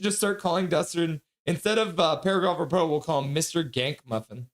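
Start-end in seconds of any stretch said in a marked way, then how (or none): none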